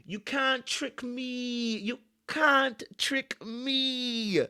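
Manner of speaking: high, very slow teasing sing-song; sing-song taunt. Acted. Host taunting Uma from his couch, slow high sing-song. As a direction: stretch it out like a playground taunt.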